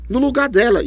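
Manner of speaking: a hint of a puzzled student; imagined student. Teacher voicing a student's doubt, mildly puzzled.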